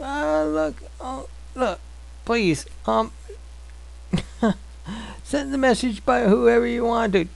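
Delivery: high, soft begging Moses; pleading Moses. Retold or imitated. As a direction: beg to be let off. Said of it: "And Moses going".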